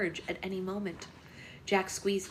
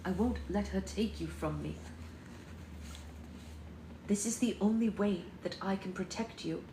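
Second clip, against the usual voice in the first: hushed tender vow; lover's plea; 6 dB quieter than usual. A narrator's reading. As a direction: quietly hold her close with the words. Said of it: "he cried".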